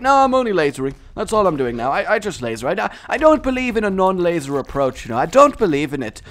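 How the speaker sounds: high, lilting Welsh protest; loud, insistent, Welsh-tinged voice; a bit Welsh. Pretend. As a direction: slip into a slightly Welsh accent. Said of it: "went a little bit Welsh".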